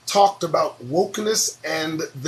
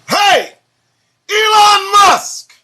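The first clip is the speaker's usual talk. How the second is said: slow, much higher and louder hammed-up call; 14 semitones higher, 9 dB louder, much slower than usual. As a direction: bellow his name like hailing him across a room. Reading his tweet to Musk aloud.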